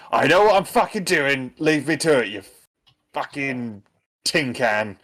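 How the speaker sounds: snapped back high and sharp, cursing; a mechanic fed up with backseat advice; irritated retort. Acted. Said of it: "Trent retort".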